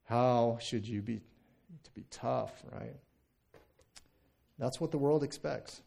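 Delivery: ordinary talk, nothing marked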